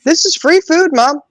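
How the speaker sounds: put-on a high kid protesting to Mom; kid's voice. Sons explaining free food to Mom, high whiny kid voice. Mother voicing her sons' reply.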